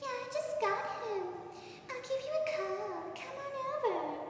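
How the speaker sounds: high sing-song imitation of the puppy girl; imitating the girl. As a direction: float it out sweetly and oddly. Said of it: "She's like".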